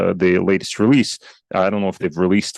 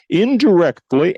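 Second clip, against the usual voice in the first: indirectly said higher, louder and slower, rising at the end; stress on indirectly; 9 semitones higher, 4 dB louder, slower than usual. Real, not acted. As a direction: lean hard on indirectly and lift it. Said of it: "I emphasize the word indirectly".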